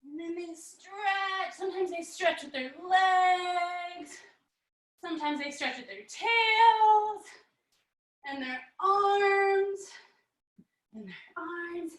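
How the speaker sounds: soft, slow, lightly sung stretch cues; yoga guidance. Acted. Teacher guiding kids through cat stretches, softly sung.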